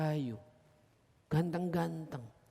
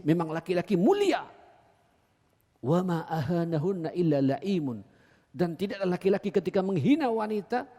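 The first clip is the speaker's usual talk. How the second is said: preaching at full force; 3 semitones higher, 7 dB louder than usual. Acted. Preacher quoting the Prophet.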